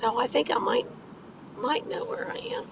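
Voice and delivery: quiet muttering under breath; mumbling to yourself while driving; muttering to myself. Real, not acted. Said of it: "I'm muttering to myself".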